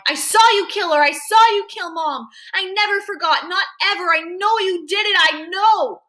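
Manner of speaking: high, loud, insistent accusing cry; screamed accusation. A narrator's reading. Boy accusing his father of killing Mom, screamed accusation. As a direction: hurl the accusation at him.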